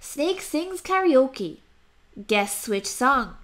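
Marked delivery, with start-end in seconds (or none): none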